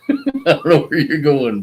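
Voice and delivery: laughing through the words. Real, not acted. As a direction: talk while cracking up. Laughing at friend riding off.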